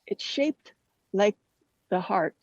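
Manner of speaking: ordinary delivery, nothing marked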